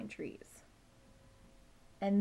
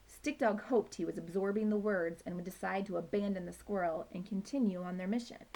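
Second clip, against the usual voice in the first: plain reading voice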